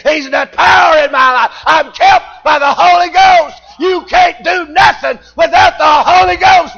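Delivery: loud, high, hammering preacher's cadence; full-force preaching. Acted. Preacher shouting the gospel to his congregation, full force. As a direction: preach it out with rising fire.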